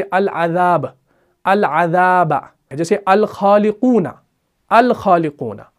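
slow "अल अजाब" and "अल खालिकूना" with a clear lam; careful drill reading; Arabic examples. Shown as a demonstration. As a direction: sound out each al clearly and slowly. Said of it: "इसकी practice करनी है".

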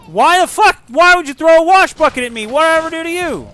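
high, loud furious protest; shouted indignation; outraged yell. Real, not acted. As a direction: yell at the enemy who hit you. Hit by bucket in game.

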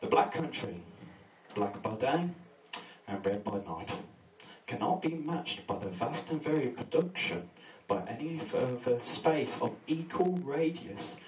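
slow, soft recital; poem reading. Acted. Comic recites a found poem to the audience, soft recital. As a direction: read it like a solemn poem. Reads poem about Cradley Heath.

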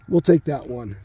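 normal talking voice

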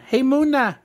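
high, loud excited shout of the name; excited call. Acted. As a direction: call out the name with sudden delight. Calling out to a Munna card.

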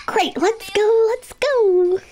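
high, eager, slow let's go; excited girl rushing off to lunch. Acted.

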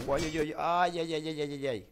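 normal talking voice

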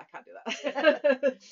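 loud, high, drawn-out mock protest; laughing protest. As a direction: exclaim it, breaking into a laugh. Mock protest at endless list, laughing.

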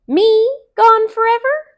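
high loud mocking call. Rabbit calls from the briar patch, loud. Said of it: "called Brer Rabbit".

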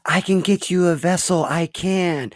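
begging the demon for her life; desperate plea. Acted. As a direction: blurt it out in panic to save herself. Cora begs the demon desperately.

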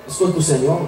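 ordinary delivery

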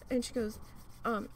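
usual speaking voice

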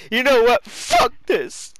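high, loud mock outburst; exaggerated imitation. Repeating the character's line for laughs, exaggerated high yell. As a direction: blow the quote up into a yell.

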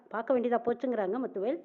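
usual speaking voice

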